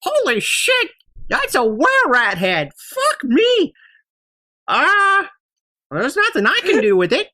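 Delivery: put-on high, wide-eyed old man yelling; old shopkeeper freaking out. Shopkeeper gasps at the were rat head, high startled old man. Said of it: "he's like".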